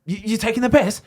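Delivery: high, mock indignant snap; hammed-up offended shout; mock snapping. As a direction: play a riled-up teammate, half laughing. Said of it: "he snapped".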